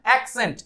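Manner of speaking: एक्सेंट as AK-sent, with a clear k; correct pronunciation. Shown as a demonstration. Teacher modelling the correct word, carefully pronounced. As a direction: sound the k firmly in एक्सेंट. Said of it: "ये है correct pronunciation".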